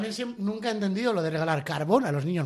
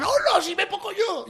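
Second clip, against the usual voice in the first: put-on excited child; 13 semitones higher, slower than usual. Children exclaiming, retold.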